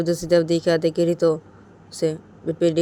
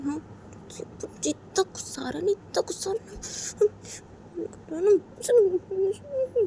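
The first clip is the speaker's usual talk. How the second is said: high, whispered sleepless grumbling; hushed annoyed self-talk; frustrated whisper; 9 semitones higher, 4 dB quieter than usual. A narrator's reading. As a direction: fume quietly in bed, unable to sleep. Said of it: "Ritu अपने बोलती".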